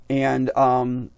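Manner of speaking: ordinary talk, nothing marked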